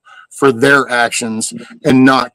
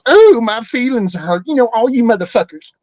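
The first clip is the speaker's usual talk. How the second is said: high, put-on whine mocking the easily offended; hammed-up high whimper of hurt feelings; mocking whiny voice; 9 semitones higher than usual. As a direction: squeak it out like a sulky child. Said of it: "it's so easy to go".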